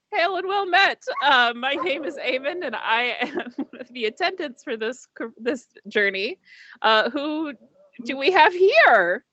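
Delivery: lifted, courteous host voice; ship attendant's greeting. Acted. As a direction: greet arriving guests as their appointed attendant. Game master voices attendant greeting guests.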